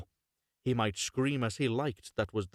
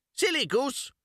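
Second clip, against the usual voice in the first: much higher, louder scoffing witch; scornful witch; 4 dB louder than usual. Acted. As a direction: scoff at the dull girl. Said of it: "said the old woman".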